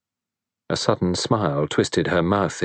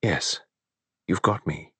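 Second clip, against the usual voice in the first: quiet tender reassurance to her husband; gently; 4 dB quieter than usual. Acted. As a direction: ease him gently with a soft yes. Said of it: "She said gently".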